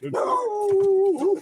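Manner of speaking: praising his dog in a high pet voice; high pet-praise voice. Real, not acted.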